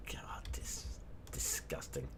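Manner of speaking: breathy disgusted whisper; real whisper. Real, not acted. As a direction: mutter it under your breath, appalled. Appalled aside after the rant.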